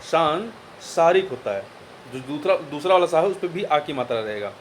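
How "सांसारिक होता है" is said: सांसारिक said slowly, syllable by syllable; slow down so the class catches सांसारिक; teacher repeats the correct word slowly for students; slow, deliberate. Shown as a demonstration. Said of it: "सांसारिक नहीं होता".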